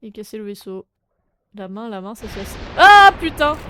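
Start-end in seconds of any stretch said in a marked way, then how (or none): 2.8-3.6 loud high curse, bursting out; frustrated shout. Real, not acted. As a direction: yell it in sudden frustration. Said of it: "Désolé pour vos oreilles".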